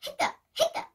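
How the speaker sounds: breathy mock hiccups, no voice; hiccup noises. Shown as a demonstration. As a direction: act out the hiccups. Acting out hiccups.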